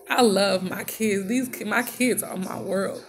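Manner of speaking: usual speaking voice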